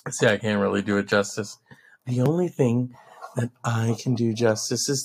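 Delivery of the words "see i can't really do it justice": ordinary delivery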